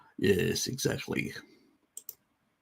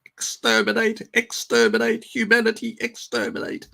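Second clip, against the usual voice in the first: high robotic Dalek chant; 11 semitones higher than usual. Retold or imitated. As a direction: grate it out like a Dalek. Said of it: "It's like a Dalek".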